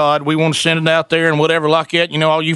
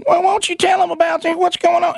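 high, mock wife voice cutting in; mimicking the wife; 11 semitones higher than usual. Wife butting into the radio show, high mock voice. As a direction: jump in high like the nagging wife. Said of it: "interrupted by his wife".

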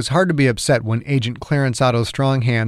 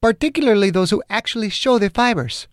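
much higher-pitched heroine's voice; persistent woman caller; 9 semitones higher than usual. Acted. Margaret presses Chang about genuine fibers, persistent. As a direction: press the question in a high female voice.

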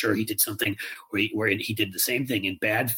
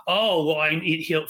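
mock Ted Cruz; 6 semitones higher, 4 dB louder than usual. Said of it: "his debate tactics to say".

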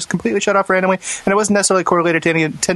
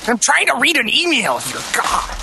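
high, slow, fed-up complaint; mock exasperation; 9 semitones higher, slower than usual. Reader keeps getting cut off by the hosts, mock exasperation. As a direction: act put upon by the interruptions. Keeps being interrupted while reading.

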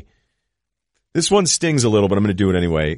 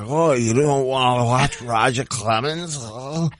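put-on slow, higher-pitched botched Boston accent; Edgerton; 4 semitones higher, slower than usual. Podcaster mocking an actor in a Boston film, bad accent. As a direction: do a terrible hammered-mouth North Shore accent.

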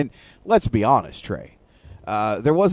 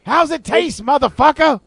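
Samuel L. Jackson voice. Pretend. Chappelle sketch Jackson voice.

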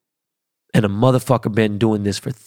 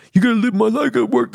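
much higher mocking voice of a careless commuter; mocking whiny excuse; 12 semitones higher, much faster than usual. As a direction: mimic a whiny excuse, pitched way up. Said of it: "I get".

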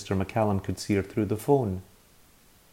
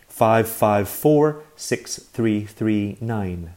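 slow dictation; 2 semitones higher, 5 dB louder, much slower than usual. Acted. Reading a number out to dial.

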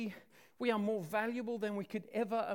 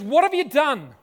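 loud, raised-pitch parental demand; scolding shout, higher and much louder; angry parent outburst; 4 semitones higher, 12 dB louder than usual. Acted. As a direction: explode at the children. Acts out angry parent response to children.

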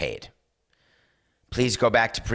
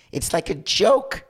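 voice cracking; 10 semitones higher, 3 dB louder than usual. Real, not acted. Host scoffing at the boosters, voice cracking. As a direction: voice cracks up high in exasperation. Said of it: "my voice just cracked that way".

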